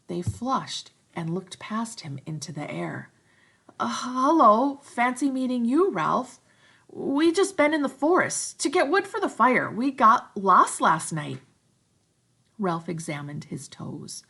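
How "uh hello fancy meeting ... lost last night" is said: put-on higher, louder flustered boys; awkward twins. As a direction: cover it awkwardly. Twins embarrassed.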